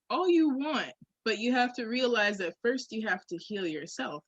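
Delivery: ordinary talk, nothing marked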